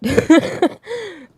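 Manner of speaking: loud high burst of laughter; laughing about the trash player. Real, not acted. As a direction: crack up between the lines. Laugh between takes.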